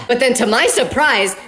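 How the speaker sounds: normal talking voice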